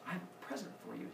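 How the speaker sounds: very soft, hushed offer; meek, quiet mock coaxing. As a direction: dangle a gift in a small voice. Uranus stalls with a lure.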